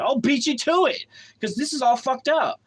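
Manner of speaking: defiant retort. Retold or imitated. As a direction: re-enact racing him to it, indignant. Defiant retort to trucker, retold.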